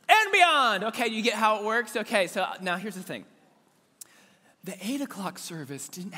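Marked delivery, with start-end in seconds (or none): 0.1-0.8 higher, louder "And beyond" as a rally reply; answering shout. Shown as a demonstration. Pastor demonstrating the other side's loud reply. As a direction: throw back the answer hard. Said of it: "this side over here".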